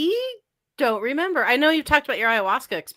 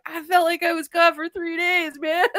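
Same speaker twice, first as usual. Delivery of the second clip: high-pitched, wailing mock amazement; imitated dazed tripper; 7 semitones higher than usual. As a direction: play an overwhelmed man reporting his trip. Imitating man after the drug hit.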